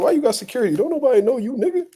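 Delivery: lightly voiced high taunt; mock taunt. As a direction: imitate the rival's teasing jab. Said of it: "He said".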